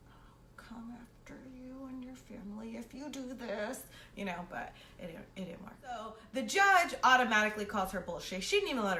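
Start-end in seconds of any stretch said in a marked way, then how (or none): 0.1-3.8 unvoiced menacing whisper; whispered threat. Silently threatening the girl across the courtroom, in a whisper. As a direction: hiss the unspoken warning with your eyes.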